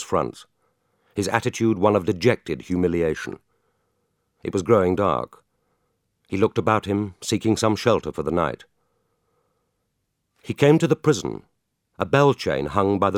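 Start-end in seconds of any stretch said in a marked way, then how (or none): none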